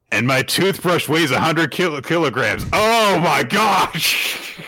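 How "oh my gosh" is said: much higher, drawn-out oh my gosh; slow disbelieving exclamation; stunned gasp. Real, not acted. Reacting to a hundred-kilo toothbrush, stunned and drawn out.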